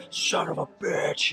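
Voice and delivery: high, slow strained curse; straining lifter. Retold or imitated. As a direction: grit it out mid-rep like a lifter. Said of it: "he's like".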